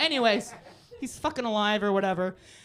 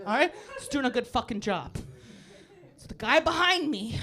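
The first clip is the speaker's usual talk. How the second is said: normal talking voice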